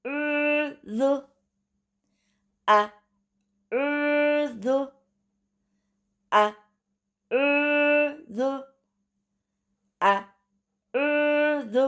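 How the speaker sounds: अर्ज़ drawn out very slowly, vowel held long before the z; drawn-out recitation. Shown as a demonstration. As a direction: hold अर्ज़ out slowly and evenly. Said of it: "इस तरीके से याद करने पर आपके मखरज सही अदा होंगे".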